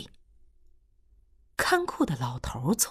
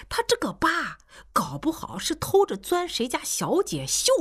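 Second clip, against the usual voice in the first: sly, higher mocking old man; teasing gossip; 7 semitones higher than usual. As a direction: spin a naughty rumour to make everyone laugh. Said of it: "看库老头儿就又调侃说". (看库老头儿调侃苟老师，语带戏谑)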